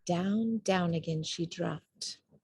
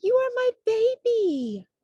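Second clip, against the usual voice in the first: joyful recognition; 6 dB louder than usual. A narrator's reading. Mother finds her baby.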